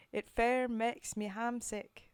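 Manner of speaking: put-on slow, loud stab at a Scottish accent; attempted Scottish accent. Streamer reading a poem to chat in a slow, loud attempted Scottish accent. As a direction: lean into a Scottish brogue, a bit unsure. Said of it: "I have an attempt at an accent".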